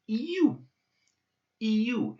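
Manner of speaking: "you" as a clear yu sound; "you" said crisply and demonstrated; Russian yu. Shown as a demonstration. Teacher showing the "you" letter sound. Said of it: "the sound is".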